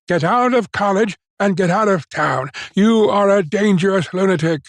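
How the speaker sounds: high-pitched, emphatic telling-off; stern dismissal. A narrator's reading. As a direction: throw him out with stern authority. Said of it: "pointed sternly to the door".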